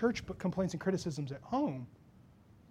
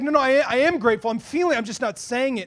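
insistent, raised-voice objection; less dramatized protest; 4 semitones higher, 9 dB louder than usual. Retold or imitated. Wife defending herself to her mentors, insistent. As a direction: protest earnestly without hamming it up. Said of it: "that tone wasn't like that".

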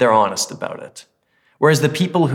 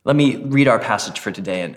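ordinary delivery

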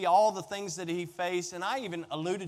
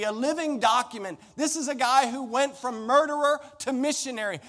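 loud, high, driving sermon delivery; preaching at full force; 6 semitones higher, 7 dB louder than usual. Acted. Pastor preaching Paul's words to the congregation, full force. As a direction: build it up and pound home each line.

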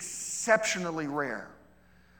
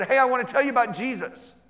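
higher and louder, bright eager offer; eager friend; 9 semitones higher, 10 dB louder than usual. Retold or imitated. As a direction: pitch it up like a keen friend sharing news. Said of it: "they said".

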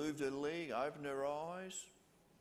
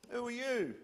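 mocking retort; 9 semitones higher than usual. Mocking mourners.

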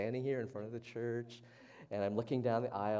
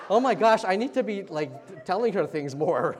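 higher, louder burst of excited realisation; 9 semitones higher, 17 dB louder than usual. Retold or imitated. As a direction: blurt it out as if just realising. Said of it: "I was like".

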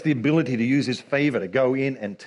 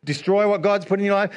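ordinary delivery

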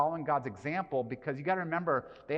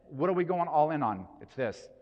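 usual speaking voice

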